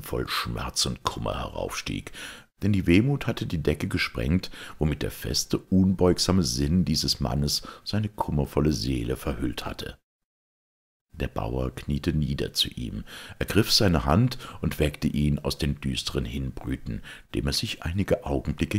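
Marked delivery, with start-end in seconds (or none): none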